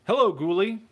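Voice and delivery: normal talking voice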